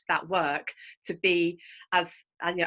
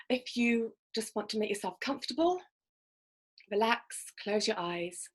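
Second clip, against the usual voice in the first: guided meditation; 6 dB quieter than usual. Acted. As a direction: gently guide listeners into calm. Leads a breathing visualization.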